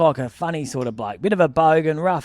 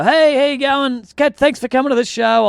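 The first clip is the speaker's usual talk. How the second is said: higher, louder call to passers-by; acted hearty greeting; 8 semitones higher, 4 dB louder than usual. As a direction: call out thanks as people file past. Re-enacts greeting departing audience.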